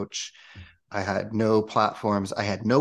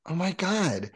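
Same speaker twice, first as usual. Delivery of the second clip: higher, sudden realization; surprised oh my God; 9 semitones higher than usual. Real, not acted. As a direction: gasp as it dawns on you. Remembering she has shrooms.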